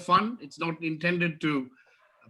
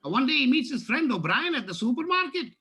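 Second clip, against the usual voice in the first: a light Irish lilt throughout; lightly voiced Irish storyteller; Irish accent joke telling; 5 semitones higher than usual. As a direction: tell the Paddy joke in a mild Irish brogue.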